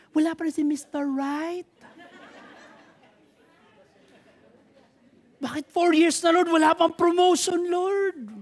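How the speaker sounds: high, pleading complaint to the Lord; whiny complaint. Retold or imitated. Impatient believer complaining to the Lord, high and whiny. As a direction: voice the impatient believer nagging God.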